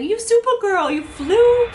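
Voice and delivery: high, loud cheery tour guide voice; tour guide impression. Tour guide cheering her as Supergirl, high and loud. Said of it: "He was calling me Supergirl".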